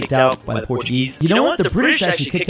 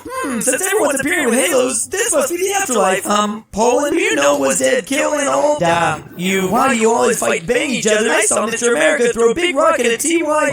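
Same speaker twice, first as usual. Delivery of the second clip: high-pitched cartoon figure; comic afterlife character; 10 semitones higher than usual. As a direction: puzzle it out in a squeaky voice.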